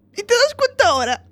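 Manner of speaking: high, exasperated disbelief; incredulous. Retold or imitated. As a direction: sound fed up and amazed. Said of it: "Y yo".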